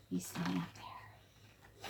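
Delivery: low breathy hush; whisper. A narrator's reading. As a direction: lean in close and whisper. Storyteller whisper.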